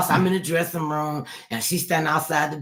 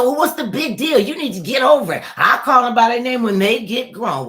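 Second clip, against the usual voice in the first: mock dismissive relative; 8 semitones higher, 7 dB louder than usual. Retold relative dismissing her rules.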